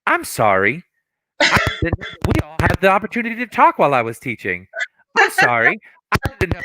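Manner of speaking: high, sing-song mock "I'm sorry"; student imitating the angry teacher's fake apology; sarcastic apology. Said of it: "when you get really mad, you go".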